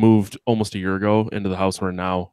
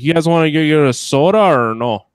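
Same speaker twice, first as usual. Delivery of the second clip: a higher, louder mock Wisconsin accent; 7 semitones higher, 7 dB louder than usual. Mocking a Sheboygan coworker's soda invite, in a higher, louder Wisconsin accent. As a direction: send up a local Sheboygan guy in a Wisconsin accent. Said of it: "this one guy would be like".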